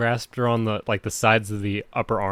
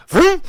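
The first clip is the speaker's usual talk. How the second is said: much higher, much louder mock jump-scare blast; jump scare noise; 13 dB louder than usual. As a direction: belt the sudden movie scare sound. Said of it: "they're gonna be like".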